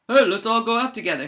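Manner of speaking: fast, lightly voiced cheerful invite; a hint of a cartoon bear, quick; eager bear. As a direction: rattle off the offer brightly. Bear proposing a double date.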